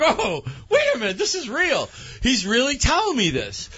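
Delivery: very high-pitched mock panic; alarmed realization. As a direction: re-enact sudden disbelief way up high. Friend re-enacts realizing news is real.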